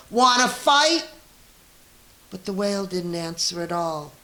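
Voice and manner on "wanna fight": high, loud challenge from a tiny bug; grouchy ladybug. A narrator's reading. Ladybug squaring up to the rhinoceros, high and loud. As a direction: squeak it out like a picking-a-fight bug. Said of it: "said the grouchy ladybug".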